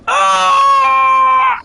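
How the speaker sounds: high, drawn-out mock scream of terror; imitated screams. Voicing screams from the boss room, shrieking. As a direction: shriek like a player getting wrecked. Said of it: "This is all you hear".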